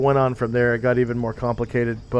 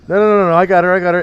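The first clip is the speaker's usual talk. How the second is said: rapid, loud, raised mock refusals; insistent thug; 6 semitones higher, 9 dB louder, much faster than usual. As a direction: wave them off loudly, imitating the guy. Acted thug grabbing the baby.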